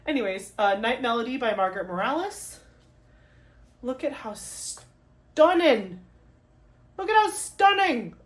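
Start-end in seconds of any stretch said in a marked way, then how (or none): 3.8-6.0 slow, savouring awe; drawn-out admiration. Real, not acted. Vlogger shows off the artwork, slow and admiring. Admires the card, first take. 7.0-8.2 high, loud gushing squeal; squealed admiration. Real, not acted. Vlogger shows off the artwork, squealing in delight. Admires the card, repeated higher.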